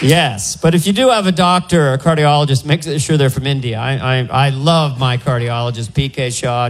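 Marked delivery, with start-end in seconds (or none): none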